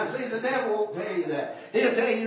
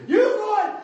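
sudden high shout to his wife; 12 semitones higher, 7 dB louder than usual. Retold or imitated. Preacher shouting to his wife to go talk to police, loud. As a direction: bark the order over the preaching.

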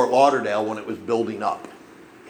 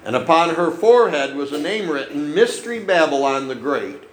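raised, declaiming Bible reading; preacher reading Revelation aloud, raised and declaiming; scripture reading; 4 semitones higher than usual. Acted. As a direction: proclaim the verses to the congregation.

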